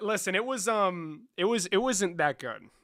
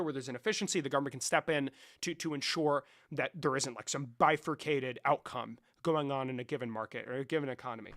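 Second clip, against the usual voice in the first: normal talking voice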